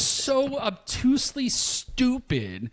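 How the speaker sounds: can't believe how dense they are; incredulous exasperation. Acted. Host ranting at ignorant protesters, incredulous and exasperated.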